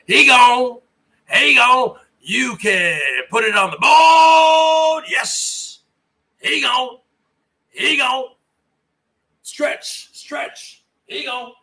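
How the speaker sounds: home-run call from a booming shout down to a hush; Hawk Harrelson call. Retold or imitated. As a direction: play the announcer roaring it gone, then easing to a whisper. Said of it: "As the great Hawk Harrelson".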